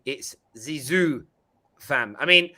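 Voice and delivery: ordinary delivery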